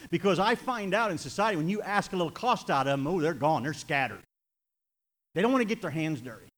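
full-force revival preaching; fiery preaching. Real, not acted. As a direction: preach it hard from the pulpit.

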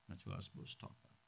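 quick murmured aside under the breath; whispered aside. Real, not acted. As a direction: mutter it to yourself. Aside to himself mid-sermon.

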